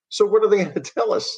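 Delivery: high, fast, amused laugh through words. Real, not acted. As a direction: laugh as you ask it. Mocking the NASA inquiry.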